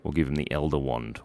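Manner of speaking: usual speaking voice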